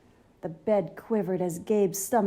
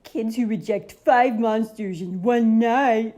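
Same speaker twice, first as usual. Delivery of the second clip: louder, mock-ominous caution; monster warning; 2 semitones higher, 8 dB louder than usual. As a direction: warn the kid in a goofy monster voice. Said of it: "he warned".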